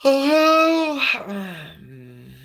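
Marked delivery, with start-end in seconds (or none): 0.0-1.0 much higher, much louder hello?; a loud, high hail for listeners; calling out. Real, not acted. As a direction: call out to an empty chat. Calling out to silent chat.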